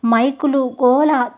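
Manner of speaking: ordinary delivery, nothing marked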